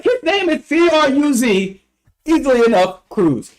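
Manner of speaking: high, slow, letter by letter; exasperated spelling-out. Retold or imitated. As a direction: spell it out like she should already know. Retold exasperated spelling of the name.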